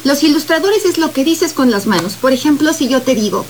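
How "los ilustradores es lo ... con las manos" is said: ordinary talk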